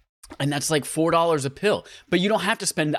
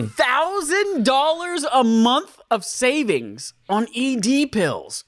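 high, slow, drawn-out sales pitch; slow mock pitch; 10 semitones higher, 4 dB louder, much slower than usual. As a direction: stretch it like an infomercial host.

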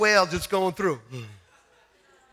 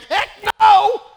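emphatic shout; 9 semitones higher, 7 dB louder than usual. Retold or imitated. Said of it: "I'm like".

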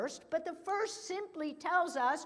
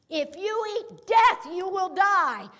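"death" and "die" punched, higher and louder; emphatic; 6 semitones higher, 7 dB louder than usual. Shown as a demonstration. Preacher repeating God's warning to Adam, forceful. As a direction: hammer each word of the threat. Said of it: "a really emphatic phrase".

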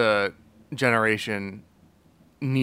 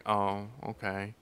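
slow and much softer, let down; mock deflated old man; 4 semitones lower, 10 dB quieter, slower than usual. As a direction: let the news sink in quietly. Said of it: "He's like".

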